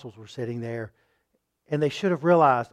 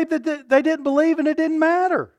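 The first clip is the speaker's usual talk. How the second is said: high, squeaky mock of doubters; mocking objector voice; 13 semitones higher, 5 dB louder than usual. Preacher mocking doubters for his congregation, high squeaky voice. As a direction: parody the people who say it.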